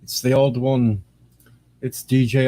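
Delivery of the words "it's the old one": a strange made-up DJ voice, lightly done; odd put-on voice. DJ opening his stream to viewers, mild put-on voice. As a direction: greet the stream in a silly put-on voice. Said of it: "why I'm talking like this".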